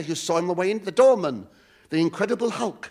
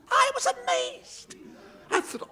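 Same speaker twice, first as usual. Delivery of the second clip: slow squeal of I was amazed; very high camp. Acted. Camp comic telling.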